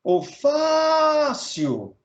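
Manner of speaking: "fácil" called out with a very long, high FÁ; "fácil" stretched on its first syllable, then falling; held stressed syllable. Shown as a demonstration. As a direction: hold the FÁ of "fácil" as if hailing someone. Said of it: "você segurou mais o fá".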